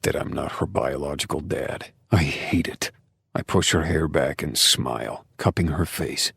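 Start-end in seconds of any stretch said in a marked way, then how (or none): none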